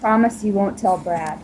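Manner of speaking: ordinary delivery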